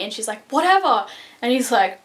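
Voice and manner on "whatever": higher-pitched sassy retort, imitating; acted-out flippant dismissal; dismissive comeback. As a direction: toss it back like a bratty brush-off. Voices Juliet's dismissive comeback.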